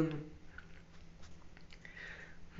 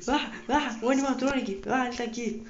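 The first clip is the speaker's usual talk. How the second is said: startled; 8 semitones higher than usual. Real, not acted. Sees animatronic, startled.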